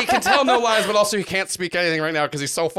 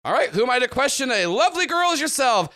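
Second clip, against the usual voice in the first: gallant then yelling; 8 semitones higher than usual. Acted. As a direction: bellow the name into the back room. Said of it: "Toolboy goes".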